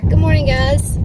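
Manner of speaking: ordinary talk, nothing marked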